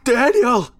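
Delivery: very high, loud scream of a name; desperate shout. A narrator's reading. Runner coming apart in their hands, screams for Daniel. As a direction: shout it with everything left. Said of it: "I shouted once more".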